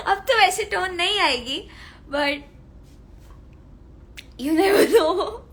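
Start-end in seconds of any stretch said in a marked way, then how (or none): 4.4-5.5 a teasing line that breaks into a laugh. Real, not acted. Teasing fans that the old tone might return, laughing. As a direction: let the laugh spill into the words.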